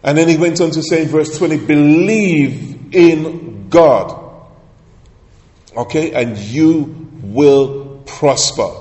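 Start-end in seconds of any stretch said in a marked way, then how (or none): 1.6-8.7 put-on broad singsong kids theatre. Preacher proclaiming the key verse.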